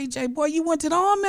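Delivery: high, fast, excited squeal of surprise; delighted disbelief up in a high voice; surprised delight. Retold or imitated. Telling the former student his surprise at the news.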